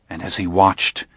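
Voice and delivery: ordinary delivery, nothing marked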